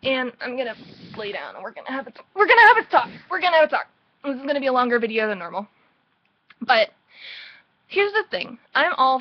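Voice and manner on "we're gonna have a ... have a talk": loud, high, insistent announcement; exasperated, emphatic near-shout; fed-up anger. Real, not acted. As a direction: raise your voice and lay down the law. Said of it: "made me really angry".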